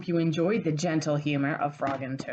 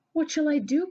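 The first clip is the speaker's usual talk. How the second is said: distressed cry; 11 semitones higher than usual. Acted. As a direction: wail it in dismay. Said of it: "cried Toad".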